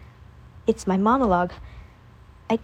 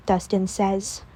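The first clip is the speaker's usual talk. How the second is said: usual speaking voice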